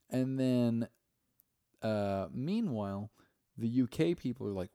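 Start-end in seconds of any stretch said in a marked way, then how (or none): none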